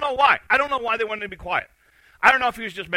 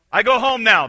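loud, raised movie-line impression; Forrest Gump; 3 semitones higher, 10 dB louder than usual. Runner stops and tells his followers, loud character impression. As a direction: belt out the half-remembered film line. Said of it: "he goes, I think, what's his line?".

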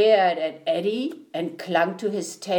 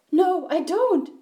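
high-pitched protest; mild shriek; 10 semitones higher than usual. A narrator's reading. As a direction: squeal a refusal. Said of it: "shrieked the voice".

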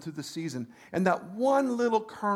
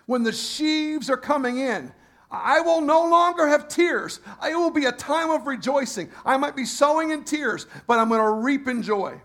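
higher, louder preaching climax; full-force preaching; 5 semitones higher, 5 dB louder than usual. Acted. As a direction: preach it out to the congregation.

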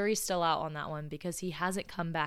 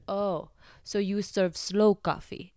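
put-on slow, louder Russian-accented question; thick Russian accent; 2 semitones higher, 6 dB louder, slower than usual. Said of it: "with a thick Russian accent".

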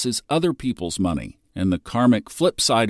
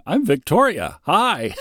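higher laughing flirty greeting; Victoria impression; 7 semitones higher than usual. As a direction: say hi through a laugh. Quoting Seinfeld Victoria.

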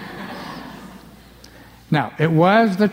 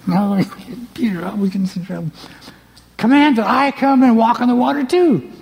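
1 Andrew's voice; 6 semitones higher than usual. Retold or imitated. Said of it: "can you imagine Andrew going".

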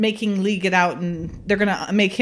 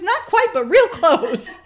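playful mock woman; 10 semitones higher, 4 dB louder than usual. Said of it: "She goes".

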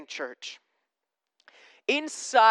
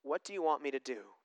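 soft Jesus; 5 semitones lower, 10 dB quieter, faster than usual. Retold or imitated. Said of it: "He says".

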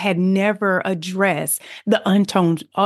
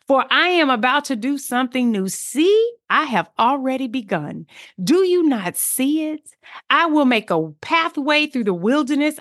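high, proclaiming reading of the verse; scripture read as preaching; fervent scripture reading; 5 semitones higher than usual. Acted. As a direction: proclaim the promise with joy. Reads scripture with fervor.

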